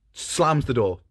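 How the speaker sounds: ordinary delivery, nothing marked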